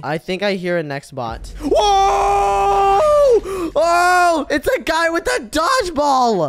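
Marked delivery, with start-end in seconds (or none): 1.6-6.5 high frightened shriek; startled screaming. Real, not acted. Monster suddenly appears.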